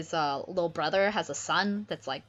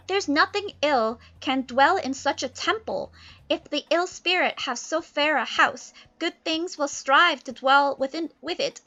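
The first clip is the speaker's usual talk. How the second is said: put-on higher, grand young prince; Ferdinand's voice; 8 semitones higher, 4 dB louder than usual. Ferdinand defends himself, grand and high. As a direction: declaim nobly. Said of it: "Ferdinand's like".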